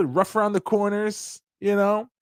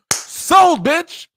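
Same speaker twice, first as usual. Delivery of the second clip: mock triumphant shout; 10 semitones higher, 8 dB louder than usual. Acted sale punchline.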